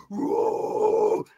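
big drawn-out growled roar, all rough noise. Retold or imitated. Bandleader acting out his staffer's account of an attacker, big growl. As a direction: imitate the big guy's long growl. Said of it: "he does this big growl thing".

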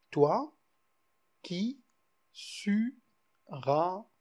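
Toi, qui, sus, ramasser spaced with pauses, each word set apart; syllables spaced out. Shown as a demonstration. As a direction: count the hemistich out word by word. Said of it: "il y a six syllabes".

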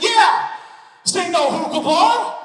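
very high, loud mock shout; imitated wife's outburst. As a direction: belt it out as the over-eager wife. Imitates wife's outburst once more.

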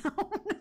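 a sudden high laugh bursting out; high burst of laughter. Real, not acted. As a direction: laugh out at the silly fight. Laughs at game fight.

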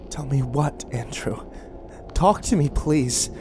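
pleading, pitch lifting midway; begging. A narrator's reading. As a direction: beg gently for him to talk. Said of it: "Frankie begged".

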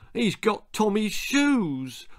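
high, slow whining wail; a boy's hopeless complaint. A narrator's reading. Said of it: "Ollie wailed".

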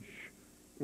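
soft unvoiced shh of the gas machine; a short hushed shh; machine hiss. Machine killing people, imitated sound.

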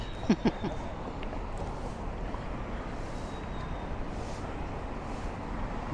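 amused chuckle to oneself. Real, not acted. Walking vlogger amused by own remark, quiet chuckle.

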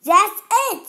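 high, loud furious scream. A narrator's reading. Captain fed up with the rats, screaming in fury. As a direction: scream it, out of patience. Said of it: "screamed the captain".

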